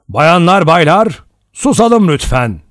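a cold, hard voice of judgement from nowhere; stern commanding voice. A narrator's reading. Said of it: "katı, etkileyici bir sesti". (sert, buyurgan bir ses)

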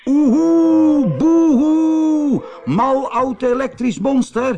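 high, loud taunting shout; shouting loudly. Acted. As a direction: yell taunts at the monster. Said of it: "riep toen heel hard".

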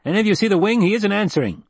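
high-pitched, irritated radio call; angry voice. A narrator's reading. Spotter on the radio about the missing wing, angry. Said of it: "erupted with an angry voice".

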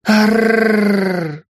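long high rolling scream; scream of pain. Acted. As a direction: howl in agony under torture. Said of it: "закричала от боли".

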